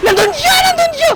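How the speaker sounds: high, squealed alarm; panicked. Real, not acted. Player spotting the enemy in the way, panicking.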